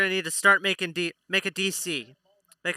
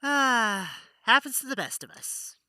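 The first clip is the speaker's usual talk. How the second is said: bard voice; 7 semitones higher than usual. Acted.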